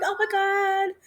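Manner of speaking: excited high-voiced greeting back; gushing reply.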